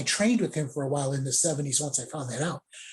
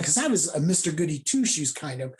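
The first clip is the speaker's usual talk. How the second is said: usual speaking voice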